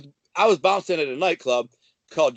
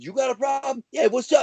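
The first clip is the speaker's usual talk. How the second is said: challenge shout; 8 semitones higher than usual. Retold or imitated. The narrator squaring up to a tough guy, shouted dare. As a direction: call him out, ready to swing. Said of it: "I said".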